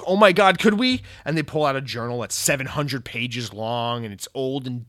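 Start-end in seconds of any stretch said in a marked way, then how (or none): 0.0-1.0 high, loud, thrilled disbelief; overjoyed gasp. Retold or imitated. Developers begged to make a game, answering: thrilled high outburst. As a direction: burst out in amazed delight. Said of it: "And Raven goes".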